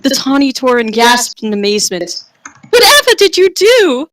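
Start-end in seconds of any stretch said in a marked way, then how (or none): 2.7-4.1 squeaky high, dainty lady voice; high girly voice. Acted. Cow woman asks the hero, squeaky and dainty. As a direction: chirp it like a delicate maiden. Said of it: "Her voice had a brawny, muscular tone".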